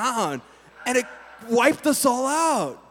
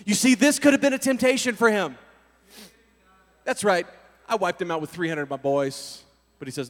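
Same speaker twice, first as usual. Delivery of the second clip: normal talking voice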